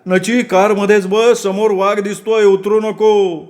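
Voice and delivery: high-pitched, drawn-out shout; screamed warning. Acted. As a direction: call out urgently to someone far off. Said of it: "Aniket जोरात किंचाळला".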